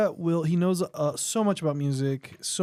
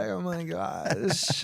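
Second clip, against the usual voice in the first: put-on Valley girl accent; 4 dB quieter, much slower than usual. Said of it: "the Valley girl accent? Like, with the vocal fry".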